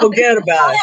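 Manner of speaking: thick New York "forget about it", run together as one word; a tough New Yorker brushing it off; New York accent. Shown as a demonstration. Friend teaching the group, in a New York accent. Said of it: "wanted nothing more than to have a New York accent".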